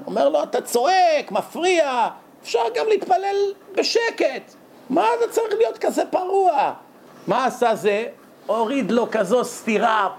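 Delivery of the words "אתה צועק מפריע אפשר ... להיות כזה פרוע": annoyed worshipper. Retold or imitated. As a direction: play a worshipper scolding the noisy one, pitch climbing high. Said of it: "הוא מתעצבן". (מתפלל עצבני מתלונן בקול גבוה)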